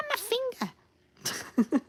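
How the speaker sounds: pained high wail. Real, not acted. Hurt finger.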